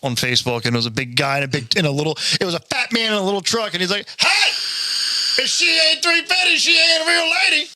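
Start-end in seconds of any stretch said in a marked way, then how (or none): 4.2-7.7 mock shout. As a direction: holler it out the truck window. Fat man yelling from truck.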